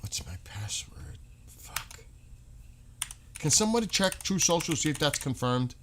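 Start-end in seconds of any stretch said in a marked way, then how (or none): 0.0-2.0 frustrated whisper under the breath; whispered mutter. Real, not acted. Streamer stuck at a login, whispering a frustrated curse. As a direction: hiss it to yourself, annoyed.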